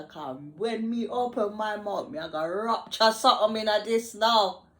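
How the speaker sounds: hammed-up Jamaican patois threat; imitating Jamaican patois. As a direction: slip into the boss's patois as it heats up. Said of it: "talking to me in her patois".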